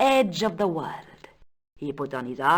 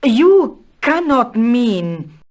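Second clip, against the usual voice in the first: higher, louder, drawn-out disbelief; slow, stunned protest; incredulous; 5 semitones higher, 8 dB louder, slower than usual. Acted. Countess to Stobart about his chess suggestion, incredulous.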